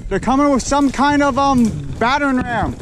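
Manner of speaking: alarmed callout. Real, not acted. Airsoft game, warning teammates.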